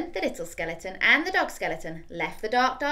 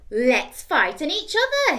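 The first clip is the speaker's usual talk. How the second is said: slow, louder, higher; little skeleton; 6 semitones higher, 7 dB louder, slower than usual. Acted. Little skeleton's idea, slow. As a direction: propose it slowly.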